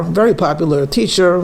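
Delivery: ordinary delivery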